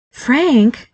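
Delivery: a wife's pointed reminder to her husband; warning tone. A narrator's reading. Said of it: "like this wasn't part of the deal".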